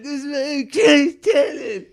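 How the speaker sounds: high, loud wailing cry of pain; mock outraged cry. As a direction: wail it like a wounded player. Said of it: "And I'm like".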